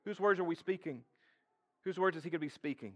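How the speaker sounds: ordinary talk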